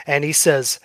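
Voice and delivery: ordinary delivery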